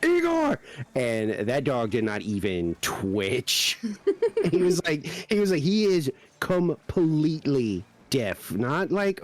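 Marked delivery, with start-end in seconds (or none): 0.0-0.6 high-pitched shout of a name; sudden acted-out yell, pitched way up; mock scream. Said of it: "scream at him like that". 5.6-8.5 "completely" broken up slowly, syllable by syllable; slow, insistent "completely"; stress on completely. Retold or imitated. Emphatic retold insistence, slowed.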